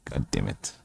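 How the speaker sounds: very low, very soft curse under the breath; frustrated mutter. Real, not acted. Reader cursing quietly at the hard dialect text. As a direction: swear quietly to yourself. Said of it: "frustrated mutter".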